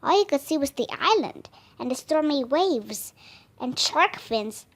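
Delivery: ordinary delivery, nothing marked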